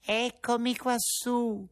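small cricket voice from within; tiny voice. Acted. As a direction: call from inside like a little creature. Cricket answers.

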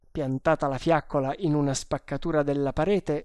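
plain reading voice

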